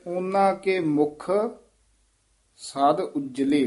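a long break after ਮੁਖ, ਸਦ light, ਉਜਲੇ tight; pause after ਮੁਖ. Shown as a demonstration. Teacher models the pause for the student. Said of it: "ਮੁਖ ਪਦ ਤੇ ਠਮਕ ਲਾ ਦੇਣੀ ਹੈ".

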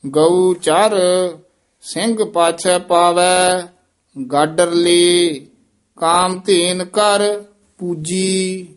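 slow, measured chant with held endings; teacher's deliberate Gurbani reading; scripture recitation. Acted. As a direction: intone sacred verse phrase by phrase.

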